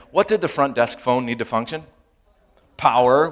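usual speaking voice

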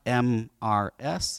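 letter by letter, waiting for it to click; pointed hinting. Retold or imitated. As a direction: spell it out as a heavy hint. Father spelling out hint slowly.

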